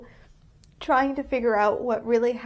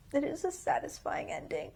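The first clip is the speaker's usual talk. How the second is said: softer and higher, voice wobbling with tears; real tears, quiet and higher; teary; 5 semitones higher, 7 dB quieter than usual. Real, not acted. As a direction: say it while holding back crying. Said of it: "I'm gonna cry thinking about it".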